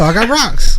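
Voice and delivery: high-pitched mock addict voice; Dave Chappelle. Quoting a TV sketch to friends, high comic impression. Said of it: "Like Dave Chappelle".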